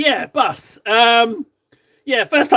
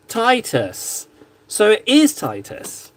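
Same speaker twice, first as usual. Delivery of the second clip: ordinary delivery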